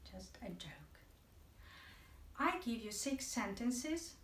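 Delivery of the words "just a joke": low, much softer joking aside; teasing aside, said low and soft; quiet aside. Real, not acted. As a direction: drop it to a soft throwaway murmur. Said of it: "so let's do some dull exercises".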